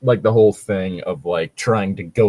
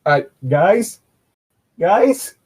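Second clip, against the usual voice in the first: louder, higher calls after them; calling out; 8 semitones higher, 5 dB louder, much slower than usual. Acted. Stuck captive calling after the players leaving, louder each time. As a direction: call them back, rising to a loud plea.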